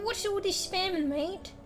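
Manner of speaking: normal talking voice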